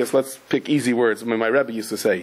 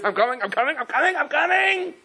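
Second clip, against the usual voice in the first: much higher, taunting sing-song cry; mocking Egyptian soldiers; 13 semitones higher than usual. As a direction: taunt like a soldier giving chase. Egyptians chasing, voiced by preacher.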